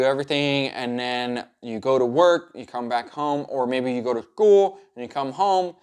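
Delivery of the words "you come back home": ordinary talk, nothing marked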